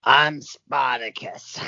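slow, drawn-out film hero impression; Kirk Douglas. Medium channels a dead actor for the guesser, slow film-star impression. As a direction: declare it slowly, as an impersonated movie star. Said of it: "That was Kirk Douglas".

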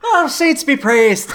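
high, slow, hammed-up Irish exclamation; mock Irish accent. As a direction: draw it out in a silly Irish lilt. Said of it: "let's hear your Irish accent".

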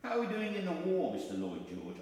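high, loud kingly question, dropping on the name; George the Fifth. Acted. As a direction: play a king questioning his minister. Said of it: "conversation between George the Fifth".

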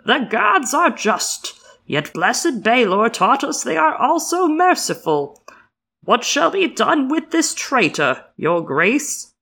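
slow, booming high priest intoning; deep swelling intoning. Acted. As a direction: intone it like a sermon from the steps. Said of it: "he intoned in a deep, swelling voice".

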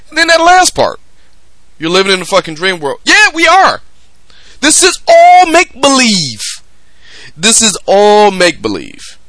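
high loud exasperated outburst; worked-up rebuttal. Real, not acted. Host ranting back at Snyder's dream world line, heated. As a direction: fire back at the quote with full force.

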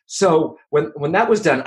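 ordinary delivery

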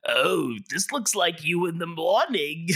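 high, slow cartoon mom voice; mom toad voice. Host quoting the toad mom, high slow teasing voice. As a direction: coo it like a flirty toad mom. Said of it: "the mom toad is like".

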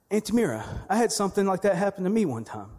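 high, loud imitation of a kid; student's voice. Eight-year-old student telling the teacher his story, imitated high and loud. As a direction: play an eager pupil piping up, pitched high. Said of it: "one of our students".

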